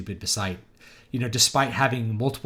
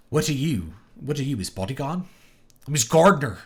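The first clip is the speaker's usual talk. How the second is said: higher-pitched imitated English country accent; hobbit's English country accent, acted out; Sean Astin in Lord of the Rings; 5 semitones higher, 5 dB louder than usual. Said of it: "hearing the country accent that, that, like, Sean Astin used".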